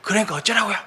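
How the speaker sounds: high-pitched mock exasperated retort; retold irritated outburst. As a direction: snap back at God in fake annoyance. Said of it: "막 성질 내고 막". (하나님께 성질내며 따지듯 반쯤 장난스러운 항의)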